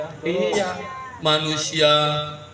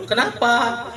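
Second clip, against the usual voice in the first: pretend Prophet asking; 8 semitones higher than usual. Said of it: "Ecek-eceknya gitulah ngomongnya ya".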